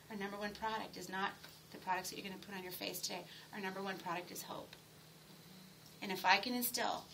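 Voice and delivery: a quiet sincere pitch to the ladies about hope; soft and sincere. Shown as a demonstration. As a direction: drop the voice and speak from the heart. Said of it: "by me speaking like that, genuinely, sincerely".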